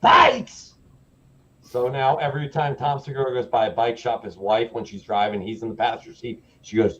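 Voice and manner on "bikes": harsh explosive shout; imitated inmate bellowing one word; prisoner's yell. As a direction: roar the word back in disbelief. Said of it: "And he goes".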